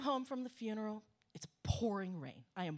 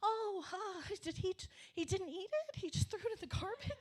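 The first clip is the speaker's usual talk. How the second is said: much higher, sugary disbelief; sweet innocent commenter; 11 semitones higher than usual. Retold or imitated. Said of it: "they're very sweet and innocent".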